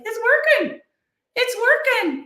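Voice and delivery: mock a defensive tech guy; high insistent protest. Christopher insists back office works.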